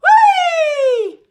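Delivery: very high, very loud shriek of whee; old woman shrieking as she flies up. A narrator's reading. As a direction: let out a wild shooting-up shriek. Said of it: "Grandma yelled".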